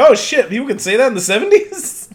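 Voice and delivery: stunned, fast blurt of disbelief; shocked. Retold or imitated. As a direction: blurt it out, caught off guard. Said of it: "I was like".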